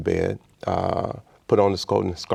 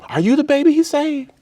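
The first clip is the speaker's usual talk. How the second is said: much higher and louder, incredulous shriek; shrieking old woman; 6 dB louder than usual. Retold or imitated. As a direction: mimic her rising shock. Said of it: "Yes, ma'am".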